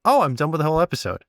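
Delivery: usual speaking voice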